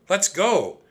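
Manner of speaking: high, loud urging call. A narrator's reading. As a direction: call down from the treehouse. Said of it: "Annie called from the treehouse".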